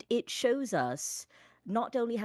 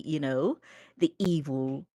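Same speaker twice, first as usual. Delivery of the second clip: normal talking voice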